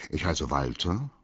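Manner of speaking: ordinary delivery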